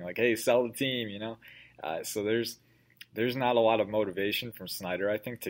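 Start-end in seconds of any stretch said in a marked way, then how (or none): 0.2-1.1 mild imitation of a shouting fan; slightly raised, louder mock yell; mock heckler's shout. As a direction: call it out like a heckler, half-strength. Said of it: "someone's shouting".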